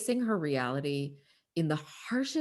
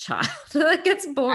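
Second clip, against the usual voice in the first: laughing; 13 semitones higher, 8 dB louder than usual. Real, not acted. Amused at barren-birth story.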